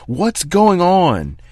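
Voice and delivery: loud, slow, high furious shout; angry yell. Acted. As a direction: bellow it at him as the door slams. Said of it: "slams the door as hard as he can and yells".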